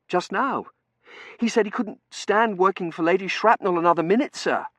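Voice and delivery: high, loud, anxious report; nervous junior. Acted. T J explaining the resignation to Dunworthy, nervous high voice.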